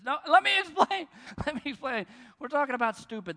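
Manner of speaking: father frantically explaining to his in-laws; panicked protest. Retold or imitated. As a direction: cry out desperately to explain before the in-laws. Panicked dad before in-laws.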